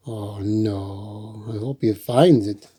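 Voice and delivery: usual speaking voice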